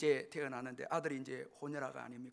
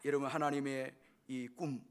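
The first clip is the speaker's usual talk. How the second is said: normal talking voice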